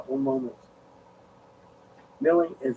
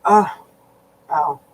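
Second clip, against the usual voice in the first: pained groan; 6 semitones higher, 7 dB louder than usual. Real, not acted. Back pain, groaning.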